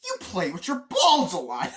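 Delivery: loud, high punchline on the edge of laughter; gleeful mock outburst; blurted accusation. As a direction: blurt it out as an acted comeback. Quotes favourite line, laughing.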